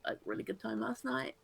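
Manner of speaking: soft, hesitant confession; shy, tender. Acted. Saying goodbye after a good night together, shy and soft. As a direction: say it gently and quietly, feeling for the words.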